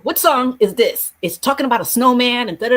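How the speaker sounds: sing-song curious teacher; teacher asking pupils about a Christmas song; excited question. Retold or imitated. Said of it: "I told one of my kids".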